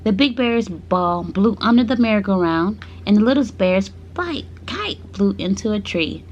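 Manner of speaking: ordinary delivery, nothing marked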